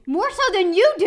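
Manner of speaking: high, loud protest; outburst. Real, not acted. Wife snapping back at husband in argument, loud and high.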